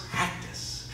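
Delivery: last repeat whispered away to nothing; soft trailing whisper. Shown as a demonstration. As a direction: let the final word die into a whisper. Repeats the word, voice fading.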